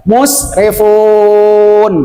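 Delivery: musrifun with the fun drawn out on a held note; long final vowel. Shown as a demonstration. Teacher shows students where to lengthen, musrifun stretched out.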